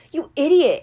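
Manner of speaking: loud fond scolding; exasperated scolding. A narrator's reading. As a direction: scold him like a relieved friend. Annabeth scolds Percy.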